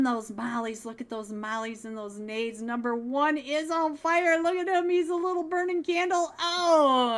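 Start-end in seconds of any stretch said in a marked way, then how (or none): 2.6-7.2 loud, high excited yelling; excited shout. Real, not acted. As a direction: yell it out as he burns. Player set on fire mid-fight.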